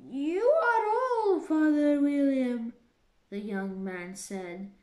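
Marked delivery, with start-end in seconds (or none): 0.1-2.7 reciting verse. Acted. Alice reciting the poem for the caterpillar, loud and high. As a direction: recite it high and grand like a schoolchild.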